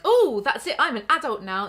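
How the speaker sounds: loud, high mock voice of a smug new adult; hammed-up naive teenager; mocking teen. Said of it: "you're like".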